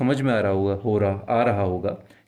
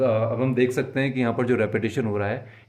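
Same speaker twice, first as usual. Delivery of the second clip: ordinary talk, nothing marked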